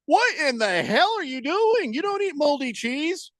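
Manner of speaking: shrill up like an outraged wife; mock scolding wife. Wife catching him eating moldy cheese, mock high scolding. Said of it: "She's like".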